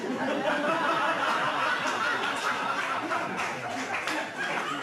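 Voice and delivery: hearty laugh at own joke; long real laugh after the punchline; laughter. Real, not acted. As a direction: break up laughing.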